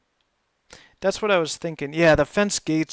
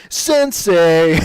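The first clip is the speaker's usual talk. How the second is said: turtle voice; 3 semitones higher, 9 dB louder than usual. Retold or imitated. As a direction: call it out like a cartoon teen turtle. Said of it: "And then the turtles would say".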